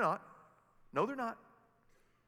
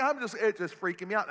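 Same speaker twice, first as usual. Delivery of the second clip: ordinary talk, nothing marked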